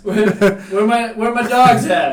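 hype call-out. Pretend.

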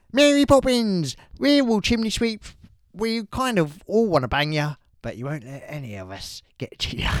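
hammed-up Cockney serenade; mock Cockney. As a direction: ham up a Cockney sweep. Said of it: "do an impression of him".

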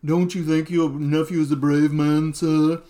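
put-on butler voice. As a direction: report dutifully to the master as his butler.

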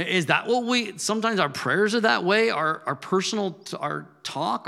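ordinary delivery

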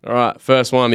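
ordinary talk, nothing marked

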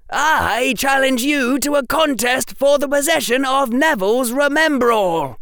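loud, high-pitched formal challenge; high, sustained declaiming shout; screamed. A narrator's reading. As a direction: proclaim the challenge at a yell. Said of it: "screamed Harry".

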